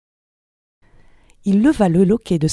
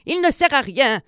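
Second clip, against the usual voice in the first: put-on high, scolding old-woman voice; mock angry cry without real volume; 7 semitones higher than usual. A narrator's reading. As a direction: complain crossly in a high put-on voice. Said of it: "cria-t-elle dans les oreilles du vieux".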